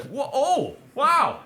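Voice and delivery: high-pitched drawn-out disbelief; taken aback. Retold or imitated. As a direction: re-enact being affronted and amazed. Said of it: "And I was like".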